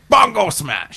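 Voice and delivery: high, loud brute roar; Hulk smash shout. Pretend. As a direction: bellow it like an angry Hulk. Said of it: "I just wanna hear bon".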